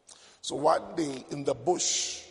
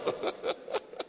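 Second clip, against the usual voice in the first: breathy laugh; 7 dB quieter than usual. Real, not acted. Preacher laughs at own point.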